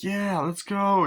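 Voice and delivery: put-on high, slow drawn-out child cheer; little kid begging to play. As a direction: stretch it like an excited toddler. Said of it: "And he's like".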